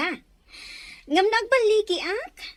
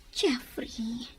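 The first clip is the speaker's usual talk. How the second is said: whisper; 5 semitones lower, 6 dB quieter than usual. Acted. Woman whispers lover's name.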